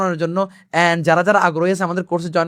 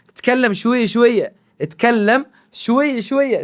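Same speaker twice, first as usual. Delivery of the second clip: slow demo; 3 semitones higher, much slower than usual. Shown as a demonstration. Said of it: "local আঞ্চলিক ভাষা আমরা বলবো".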